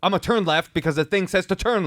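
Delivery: high, snapping mimic of the driver; mock the driver's snippy retort. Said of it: "he kinda snaps at her".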